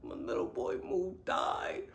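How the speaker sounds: high, thin whimper of mock grief; retold crying. Telling her best friend why she's in tears, a mock tearful whimper. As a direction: whine it like you're crying over a book. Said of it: "Moo's death and I was crying".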